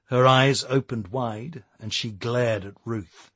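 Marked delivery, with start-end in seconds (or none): none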